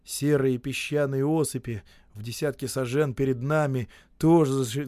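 ordinary delivery

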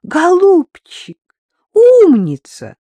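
loud, high drawn-out cries of praise; gushing delight. Acted. Drunk woman cheers on TV killers, gushing. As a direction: gush over a darling, stretching each word.

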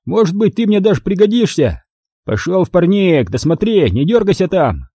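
high, condescending thug voice; smug thug. Acted. Thug smugly lets the narrator live for now. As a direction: grant mercy like a boss.

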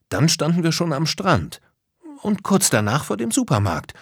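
high, eager chime-in; calling out. Acted. Lisa supplies the next stations on the route, calling out. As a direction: call out the next stop in a high voice. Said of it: "rief Lisa".

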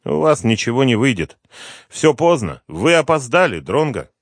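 it's all too late now; hopeless despair. A narrator's reading. Doomed father tells the detective, despairing and high. Hopeless man rejects rescue.

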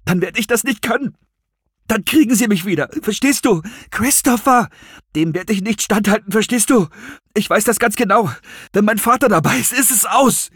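high, breathless panic; frantic, high-pitched outburst; horrified. A narrator's reading. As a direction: plead in mounting dread to be understood. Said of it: "der entsetzte Ton".

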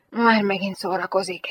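usual speaking voice

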